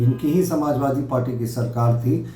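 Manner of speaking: ordinary talk, nothing marked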